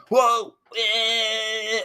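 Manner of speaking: high, loud whoop into a jeer; drawn-out high mocking noise; mocking taunt. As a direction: imitate a drunk guy's gotcha jeer. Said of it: "and he's like".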